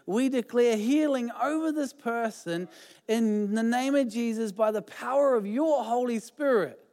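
fervent spoken prayer. Real, not acted. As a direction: pray it over them with conviction. Prays healing over person.

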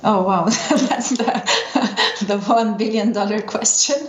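said through real laughter; laughing. Real, not acted. Guest reacts to the interviewer's one billion dollar question, laughing.

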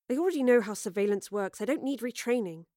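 ordinary delivery

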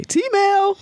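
change the mood with a cheery announcement; upbeat segue. Real, not acted. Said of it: "switching to cheerful segment".

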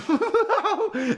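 high mock laughter; shrieky acted belly laugh; family laughing. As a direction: imitate a noisy family's cackle. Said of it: "they would be laughing".

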